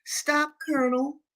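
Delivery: high-pitched, pleading wife; admonishing lady. A narrator's reading. Lady begs the Colonel to stop, admonishing. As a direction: raise the pitch sharply, as a lady cautioning her husband. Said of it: "admonished his lady".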